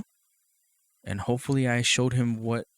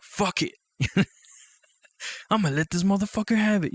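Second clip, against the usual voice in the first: mock fed up; 9 semitones higher, 3 dB louder than usual. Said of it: "I was like".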